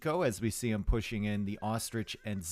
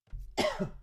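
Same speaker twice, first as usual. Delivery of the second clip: breathy voiceless cough; last sputtering cough; wheezing cough; real coughing fit; 4 dB quieter than usual. Real, not acted. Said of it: "I'm crying".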